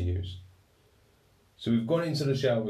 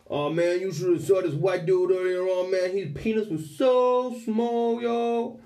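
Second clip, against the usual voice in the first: much higher, louder, slow put-on accent; mocking inmate; 9 semitones higher, 4 dB louder, slower than usual. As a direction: gossip and mock the new guy. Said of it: "they might be like".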